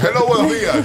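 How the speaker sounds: sing-song host greeting. Acted. Radio host singing hello to a new caller.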